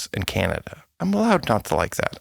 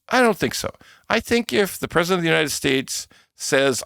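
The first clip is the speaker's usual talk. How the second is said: usual speaking voice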